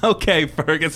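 mock reply. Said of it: "And you're like".

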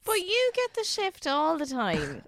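drawn-out playful whine; slow high teasing protest. Real, not acted.

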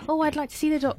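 lifted, sing-song voice for the doctor's receptionist; phone voice. Retold or imitated. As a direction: raise the pitch to sound extra polite on the phone. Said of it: "the pitch might go a bit higher if I'm on the phone".

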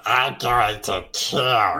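slow, eerie killer clown; Art the Clown voice. Pretend. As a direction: draw it out like a creepy clown. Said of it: "what I reckon Art the Clown would sound like".

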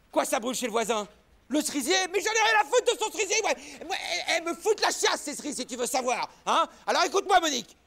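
angry husband shouting at his wife; furious phone rant. Acted. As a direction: rant down the phone, pitched high.

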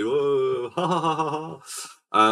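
mock ghoulish laugh, drawn out; hammed-up laugh of a comedy Death; comical Death cackle. Vampire playing Death for a comic play, mock cackle. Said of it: "one of the vampires is playing death".

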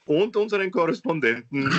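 usual speaking voice

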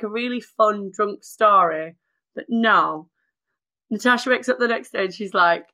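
ordinary talk

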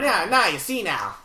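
put-on slow old-time gangster; twenties gangster voice. Copying co-host's demo, twenties gangster voice. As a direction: try out a mobster's patter. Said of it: "twenties interviewer voice".

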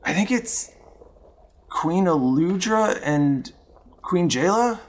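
high hesitant servant voice; halting, unsure answer; nervous NPC voice. Acted.

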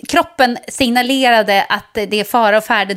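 ordinary talk, nothing marked

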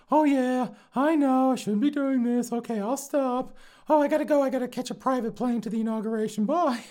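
very high put-on voice, mostly loud; mocking airhead niece. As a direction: imitate an insincere niece in squeaky falsetto. Mocks niece's reply to officials.

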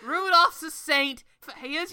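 a hammed-up, barely Irish cop accent; bad Irish accent. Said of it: "That's not even Irish".

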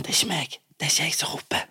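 hushed whisper disowning the shouting; whisper, not me; whispered denial. Retold or imitated. Embarrassed podcast host whispering to neighbours. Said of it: "prøvde sånn".

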